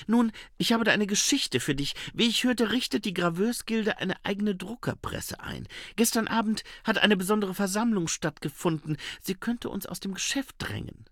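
hohe Frauenstimme einer Romanfigur; junge Reporterin. Acted. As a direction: voice her high, telling her news. (hohe Stimme, berichtet Neuigkeiten)

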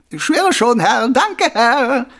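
high, loud, servile prisoner voice; eager obedient prisoner. Acted. Prisoner hurriedly obeys barking guard. (unterwürfiger Häftling, laut und hoch)

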